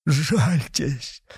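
high, weak plea; pleading. A narrator's reading. Executioner begs the avenger for mercy, pleading. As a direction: beg for pity. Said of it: "сказал палач, простирая к нему руки".

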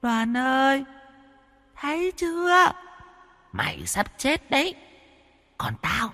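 put-on very high, thrilled child spirit gloating; gleeful ghost girl. Ghost girl gloats to dying Mr. Đoàn, high and gleeful. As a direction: crow with delight like a victorious little ghost. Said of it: "Giọng nói của nó đầy hưng phấn".

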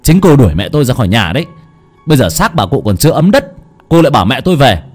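enraged husband telling his wife to shut up; furious. Acted. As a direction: lash out at her in bitter rage. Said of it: "Kha bắt đầu cáu bẩn".

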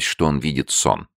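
usual speaking voice

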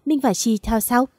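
ordinary delivery

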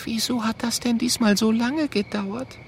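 high, hushed small voice; sleepy Mathilda. Acted. Mathilda questioning Oskar at night, high and hushed. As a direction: ask it softly in a high voice.

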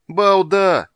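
loud, high-pitched outburst from an angry king; exasperated yell. A narrator's reading. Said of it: "заорал король, хватаясь за голову". (крик с досадой)

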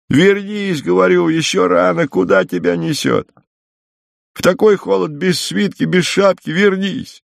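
high, insistent calling at ordinary volume; calling back. A narrator's reading. Woman worried by the cold calling her guest back, insistent call. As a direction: keep the pitch high and urge him back.

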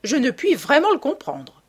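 high, emphatic bafflement; exclaiming. A narrator's reading. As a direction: exclaim it with rising pitch and force. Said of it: "s'écria la vieille dame".

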